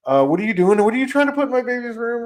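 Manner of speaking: a startled wife catching an intruder; alarmed wife. Retold or imitated. Wife confronts stranger in baby's room.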